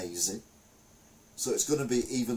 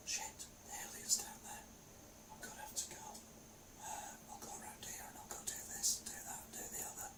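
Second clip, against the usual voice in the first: hushed whisper, fast at the end; tense whisper to himself while sneaking; 18 dB quieter than usual. Retold or imitated. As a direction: whisper as if the alien is close. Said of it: "and I'm whispering".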